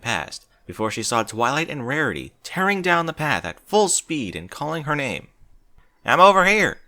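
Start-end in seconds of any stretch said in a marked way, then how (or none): 6.0-6.8 loud high call across the orchard; yell. Acted. Applejack calling out to her running friends, loud. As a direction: shout it out so they find you. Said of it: "she yelled back".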